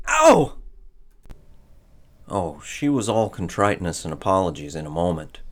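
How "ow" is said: high, loud yelp; sharp pained yelp. Acted. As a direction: cry out at a sudden slap. He gets slapped.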